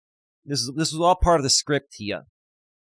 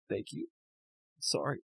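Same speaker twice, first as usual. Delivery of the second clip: much softer, choked; real crying, apologetic; tearful whisper-thanks; 3 semitones lower, 12 dB quieter than usual. Real, not acted. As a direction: barely get it out. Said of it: "I'm losing my shit".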